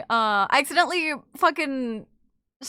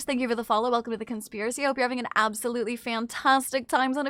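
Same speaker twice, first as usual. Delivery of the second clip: ordinary talk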